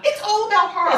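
high, outraged exclamation; high-pitched indignant cry. Real, not acted. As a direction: exclaim it in disbelief. Outraged at the apology.

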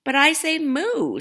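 high, loud cow voice, drawn out; a playful cow protesting loudly. Acted. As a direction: make the cow insist it says moo. Cow voiced in read story.

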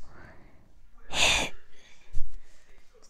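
playful breathy gasp; whispered gasp. Pretend. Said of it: "I'm trying to whisper for fun".

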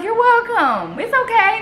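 a sugary high you're welcome; bright high reassuring tone to put him at ease; high sweet reassurance. Retold or imitated. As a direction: pitch it up and sweet, like soothing someone. Reassuring a man with Parkinson's.

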